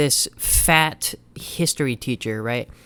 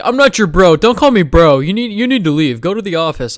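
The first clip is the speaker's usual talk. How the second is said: much louder, higher mock outburst at being called bro; angry teacher shout; 4 semitones higher, 10 dB louder than usual. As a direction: bark it like an offended teacher losing his temper.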